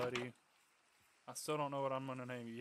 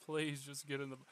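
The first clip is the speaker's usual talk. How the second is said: ordinary delivery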